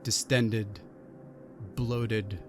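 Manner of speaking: slow, low narration with long pauses; game master's scene setting. Acted. Game master revealing a familiar face to the Inquisitor, low suspenseful narration. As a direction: let each detail land, hushed and low.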